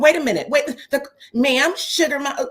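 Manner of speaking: patronizing. Retold or imitated. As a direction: talk down to her, cutting in. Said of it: "very patronizing".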